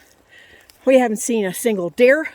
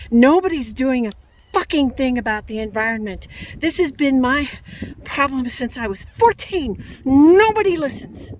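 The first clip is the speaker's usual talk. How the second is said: venting fury at nobody listening; angry and fed up, voice climbing; furious rant; 3 semitones higher than usual. Real, not acted. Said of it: "I am absolutely furious right now".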